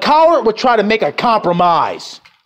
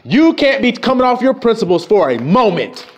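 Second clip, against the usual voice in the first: ordinary delivery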